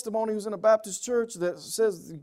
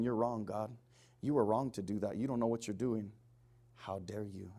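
low, subdued reproach of God; quiet, grave mock rebuke; hushed accusation; 7 semitones lower, 11 dB quieter than usual. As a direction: voice the accuser softly, dropping away. Voices people accusing God, hushed.

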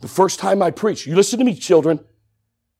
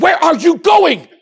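loud, high, demanding father's challenge; dad's stern shout; 11 semitones higher, 6 dB louder than usual. Retold or imitated. Dad in the church aisle confronts his son, stern shout. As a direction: bark it like a startled dad.

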